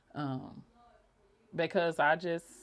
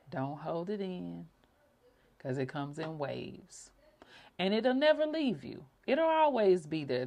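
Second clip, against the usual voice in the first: soothing guidance. Acted. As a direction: guide listeners gently through grief. Guided comfort.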